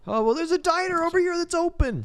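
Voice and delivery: high, loud eager voice; squeaky hammed-up delight; mock excited squeal. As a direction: spot the diner with glee. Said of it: "and then they".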